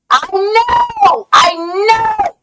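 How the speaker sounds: high wailing mock sob; mock crying. Hammed-up sobbing at a friend. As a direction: wail it like a hammed-up crybaby.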